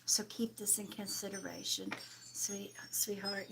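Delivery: whispered, tender aside to the viewer; soft whisper. Real, not acted. Medium whispering comfort to a struggling viewer. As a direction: breathe it softly to her.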